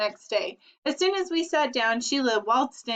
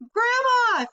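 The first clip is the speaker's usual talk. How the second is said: high shrill cry, much louder; cry for help; 12 semitones higher, 5 dB louder than usual. A narrator's reading. Little boy crying out to Grandma as brother charges. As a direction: scream for Grandma. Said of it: "Fudge had cried".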